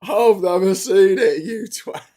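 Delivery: loud, slow mock insult; rude heckler insult. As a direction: jeer it at the star.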